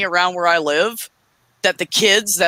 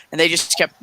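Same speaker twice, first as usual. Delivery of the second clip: normal talking voice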